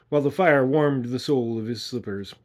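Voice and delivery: ordinary delivery, nothing marked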